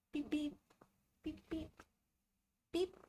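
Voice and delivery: soft, high little pet call; gentle high-pitched calling to a cat. Real, not acted. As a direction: coo the cat's name softly and high.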